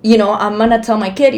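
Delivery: usual speaking voice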